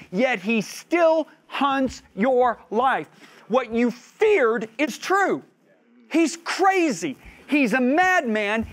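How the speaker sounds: high, slow, loud sermon build; impassioned preaching. Acted. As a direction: preach it rising to a cry. Preaching climax on David's despair.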